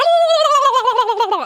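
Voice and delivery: high, loud turkey gobble; gobbling turkey voice. Retold or imitated. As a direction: call out like a turkey. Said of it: "we would just yell at each other like that".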